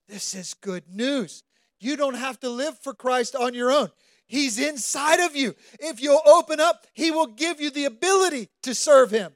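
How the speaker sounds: high, loud impassioned preaching; full-force preaching. Real, not acted. Preacher pouring out his weeping moment to the congregation, impassioned. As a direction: preach it with rising passion, near tears.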